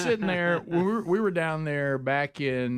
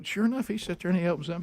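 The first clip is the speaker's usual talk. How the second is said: ordinary delivery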